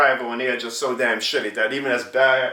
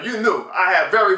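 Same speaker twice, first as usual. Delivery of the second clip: ordinary delivery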